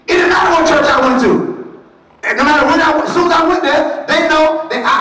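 full-force preaching. Acted. Preacher shouting at the congregation, full force.

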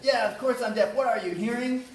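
loud mock yell of the comeback; retold shout. Retelling the deaf actor's comeback to the driver, loud mock yell. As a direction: shout the punchline back. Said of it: "caught up with the guy and said".

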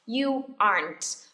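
slow "aren't" in one syllable, "ahnt"; slowly modelled "aren't", no extra vowel; correct aren't. Shown as a demonstration. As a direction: stretch "aren't" as a single "ahnt", closing on the t. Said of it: "Nhấn mạnh ở chữ aren't".

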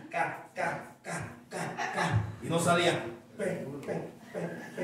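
stuttering "ca, ca, ca" with a laugh; mock his own childhood stammer; half-laughing stutter on "pe, pe"; imitating a stutter. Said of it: "Asmático y gago".

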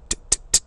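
"T-" as bare unvoiced bursts; breath hitting teeth. Shown as a demonstration. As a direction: spit "T-" with breath against the teeth. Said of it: "hitting the teeth like that".

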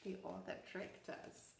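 ordinary delivery

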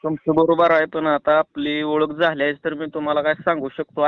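normal talking voice

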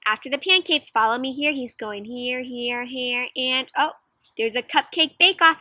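plain reading voice

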